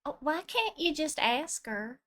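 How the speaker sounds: ordinary talk, nothing marked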